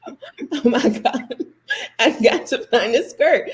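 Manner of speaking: high, drawn-out mock panic with a laugh; retold panic. Girl fretting over her lost skirt, mock panicked.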